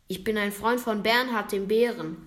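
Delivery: ordinary delivery, nothing marked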